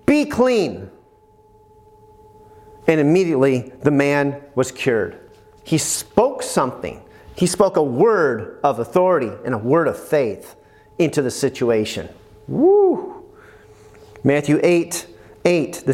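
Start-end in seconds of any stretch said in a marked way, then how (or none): 0.1-0.9 raised-pitch word of authority; firm command. Retold or imitated. Jesus commanding the man's body, firm command. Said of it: "he just commanded his body". 12.5-13.2 high sustained "woo"; excited whoop. Real, not acted. Preacher after the word of authority, excited whoop.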